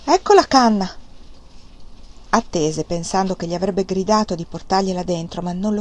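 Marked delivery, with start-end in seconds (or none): 0.0-0.9 raised, louder call through the door; a firm loud call to the next room; said aloud. A narrator's reading. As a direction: call it out so she hears. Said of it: "disse a voce alta".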